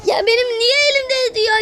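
whiny complaint. Real, not acted. Frustrated whine over game mistake.

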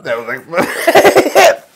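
laughing praise. Real, not acted. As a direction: say it breaking into laughter. Laughing after the baby-voice joke.

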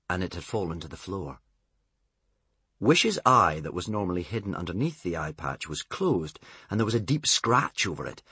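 ordinary delivery

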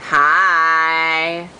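ordinary talk

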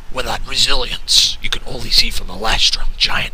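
ordinary delivery

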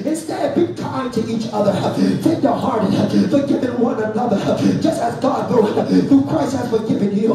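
full-force sermon delivery, driving phrase by phrase; preaching. Real, not acted. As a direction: preach hard to the congregation, punching each phrase.

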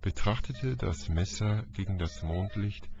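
usual speaking voice

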